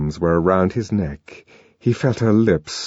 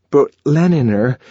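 higher, louder start of an objection; protesting; 5 semitones higher, 4 dB louder than usual. A narrator's reading. John beginning to protest to Lenina, protesting. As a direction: push back gently. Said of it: "he began protesting".

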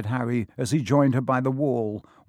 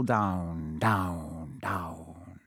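slow words sinking lower and fading; falling away; 7 semitones lower, 7 dB quieter, much slower than usual. A narrator's reading. Narrator following Harry's long fall, sinking away. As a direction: let each word drop deeper and softer.

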